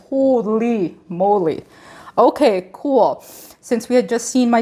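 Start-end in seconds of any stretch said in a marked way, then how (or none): 0.1-3.2 drawn-out stunned exclamation; slow, awed reaction; retold amazement. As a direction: stretch it out slowly in mock astonishment. Retold astonishment at instant result.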